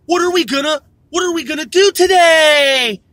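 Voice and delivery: put-on high singsong kids' show voice; exaggerated chirp swelling at the sentence end; imitating cartoon girl. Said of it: "who the fuck talks like that".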